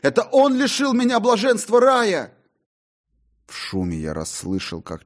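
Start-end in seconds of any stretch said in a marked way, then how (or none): 0.0-2.3 high, loud yell. A narrator's reading. John yells at Faustus across the theatre. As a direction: shout it at the stage. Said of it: "кричал я".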